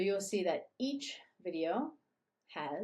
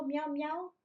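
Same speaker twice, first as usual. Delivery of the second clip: cat meow; 8 semitones higher than usual. Shown as a demonstration. Imitating cat.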